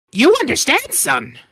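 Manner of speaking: high, halting goblin speech; broken-English little goblin. Acted. Goblin speaks.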